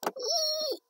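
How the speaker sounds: high delighted squeal; high excited squeal. Real, not acted.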